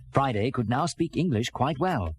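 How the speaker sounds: plain reading voice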